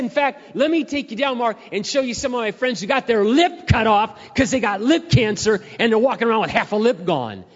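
high, loud preacher-father shout; dad's loud warning. Retold or imitated. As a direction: hammer the warning home as dad. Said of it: "He said".

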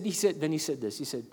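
normal talking voice

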